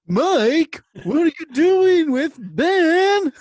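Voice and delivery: high, loud, drawn-out mock yell; hammed-up cartoon outcry, stretching the words; mock cry. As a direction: call out in exaggerated alarm, very high. Acted cartoon line, shouted in mock alarm.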